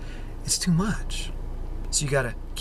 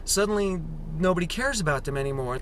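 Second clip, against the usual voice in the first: ordinary talk, nothing marked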